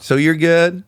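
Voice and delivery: high, drawling Southern check-in. Retold or imitated. Replaying the endless phone pleasantries, Southern drawl. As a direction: imitate a Southerner prolonging small talk.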